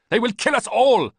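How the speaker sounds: loud, high furious warning; furious yelling. A narrator's reading. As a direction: yell the warning at the traitor. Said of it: "Ballista yelled".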